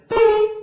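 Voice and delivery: squeaked, high loud boop. Retold or imitated. Comic mimicking the heckler's punchline, a squeaked high boop. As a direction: pop it out like a sudden squeak. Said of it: "he's like".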